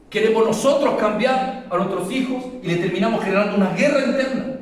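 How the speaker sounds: loud, high, driving sermon voice; full-force preaching. Acted. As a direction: preach it out to the whole church. (predicación a todo pulmón)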